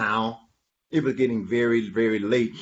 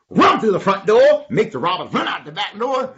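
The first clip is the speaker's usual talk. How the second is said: high, energetic urging; rally them with a lifted voice; excited plotting; 7 semitones higher, 7 dB louder than usual. Acted. Animal tells friends the plan, high excited urging.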